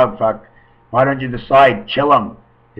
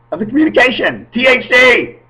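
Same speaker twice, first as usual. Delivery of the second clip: much higher and louder, exalted; rapturous outburst; ecstatic cry; 11 semitones higher, 6 dB louder than usual. Real, not acted. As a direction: proclaim it like a revelation. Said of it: "ecstatic exclamation".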